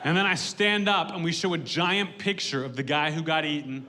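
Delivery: ordinary delivery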